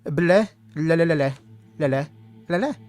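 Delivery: silly tongue flapping greeting; blubbering tongue noises; tongue-wagging blabber. Pretend. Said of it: "that's how I say hello in my native tongue".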